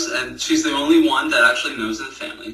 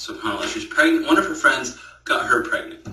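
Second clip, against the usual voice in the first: ordinary talk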